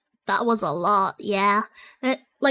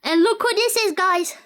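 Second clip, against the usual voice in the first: excited surprise; 6 semitones higher than usual. Real, not acted. Vlogger spots a familiar character on screen, delighted. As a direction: pitch jumps up, delighted recognition.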